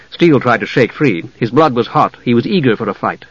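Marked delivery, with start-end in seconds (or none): none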